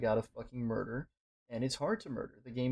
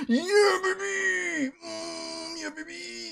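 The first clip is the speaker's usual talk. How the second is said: high, loud ecstatic shriek of victory; joyful scream; 6 dB louder, much slower than usual. Real, not acted. Gamer shrieking at a catch that finally came. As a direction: scream it out like you just won the lottery. Fishing win, screaming with joy.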